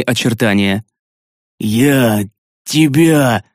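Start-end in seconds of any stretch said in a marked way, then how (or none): 1.6-3.4 very slow, word-by-word strained threat; laboured character voice, long break between words; struggling to speak. A narrator's reading. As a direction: force each word out against a binding spell. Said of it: "даже сквозь мой аркан пробился".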